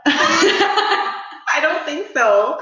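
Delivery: high, slow giggling denial; laughing. Real, not acted. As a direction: laugh it off bashfully. Laughs off compliment.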